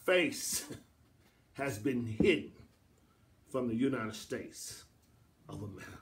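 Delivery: low, soft, with long pauses; hushed solemn pronouncement. Real, not acted. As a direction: drop right down and let each phrase hang. Solemn pronouncement after loud preaching.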